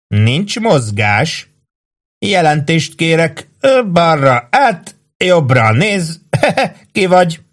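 high, slow, drawn-out commands; hammed-up drill orders breaking into a laugh; mock drill sergeant. A narrator's reading. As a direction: drag out parade orders mockingly. Mock sentry barks drill orders.

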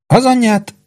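high-pitched cheerful exclamation; jolly, pitched well up. A narrator's reading. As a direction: burst out in good humour. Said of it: "szólalt meg jókedvűen Péntek".

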